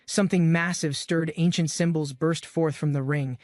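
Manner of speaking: usual speaking voice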